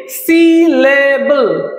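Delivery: সিলেবল stretched into three slow parts, সি-লে-বল; syllable by syllable. Shown as a demonstration. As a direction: draw out each syllable of সিলেবল. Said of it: "তিন ভাবে উচ্চারণ করছি". (তিন ভাগে টেনে টেনে সিলেবল)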